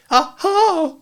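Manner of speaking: very high little squeak; anxious Piglet. A narrator's reading. Piglet looking round for the others, high anxious squeak. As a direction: squeak it hopefully, seeking backup. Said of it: "looking round anxiously for the others".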